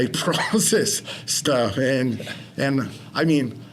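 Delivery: ordinary talk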